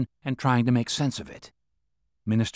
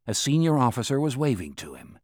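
ordinary delivery, nothing marked

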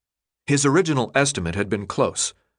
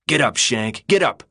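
angry order. A narrator's reading. Leader hauling the newcomer to his feet, angry order. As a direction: order him up, rising on the repeat.

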